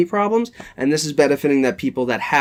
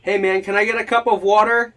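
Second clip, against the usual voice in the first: higher-pitched, offhand asking voice; acted casual request; 7 semitones higher, 3 dB louder than usual. Demonstrating asking someone for water, casual higher-pitched request. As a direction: toss it out like a real request. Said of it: "like, you can hear".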